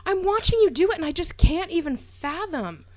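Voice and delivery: high, loud amazement; high excited disbelief; amazed. Real, not acted. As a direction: marvel out loud. Amazed at Bob Ross.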